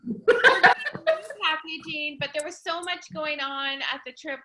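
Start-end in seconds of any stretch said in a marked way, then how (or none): 0.0-2.2 laughing. Real, not acted. Laughs, repeats news after slip.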